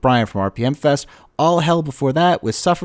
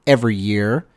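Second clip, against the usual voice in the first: ordinary delivery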